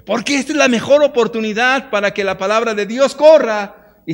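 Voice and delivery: loud, high, impassioned sermon voice; rising pulpit fervor, driving each line home; full-force preaching. Acted. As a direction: preach it out to the crowd, building.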